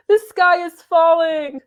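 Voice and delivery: high, gasping the sky is falling; fluttering damsel cry. Pretend. Acting the damsel.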